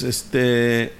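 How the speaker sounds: "este" held long on the final vowel; drawn-out este. Retold or imitated. Showing how Argentines fill pauses, drawn-out "este". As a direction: stretch "este" as a hesitation. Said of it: "Like, like they do this".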